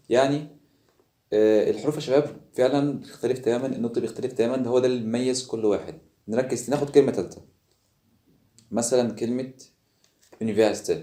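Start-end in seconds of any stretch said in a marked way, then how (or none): none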